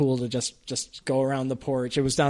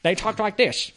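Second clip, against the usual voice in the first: hint of New Hampshire; 7 semitones higher than usual. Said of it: "people from New Hampshire".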